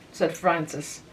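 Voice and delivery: ordinary delivery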